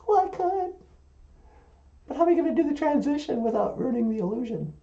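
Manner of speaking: high-pitched puppet voice; high puppet chatter; puppet character. Acted.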